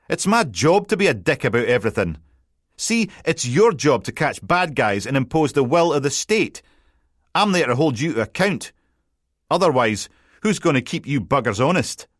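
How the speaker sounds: a light cheeky Scottish reporter voice; cocky journalist. Acted. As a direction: needle the detective with a grin. Colin lecturing the detective.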